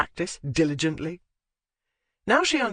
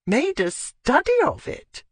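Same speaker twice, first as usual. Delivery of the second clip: put-on old lady voice; 9 semitones higher, 4 dB louder than usual. A narrator's reading. Lady Withcote voiced high.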